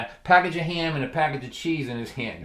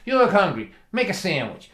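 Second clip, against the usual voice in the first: dry dad; 5 semitones higher, 4 dB louder than usual. Retold or imitated. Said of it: "In front of me, he goes".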